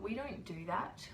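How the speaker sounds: ordinary delivery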